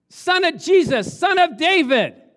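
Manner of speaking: loud, high, drawn-out call; crying out. Acted. Blind men calling after Jesus, loud cry. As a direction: proclaim it like a plea across a crowd. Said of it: "They cried out".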